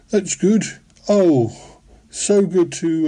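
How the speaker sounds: ordinary talk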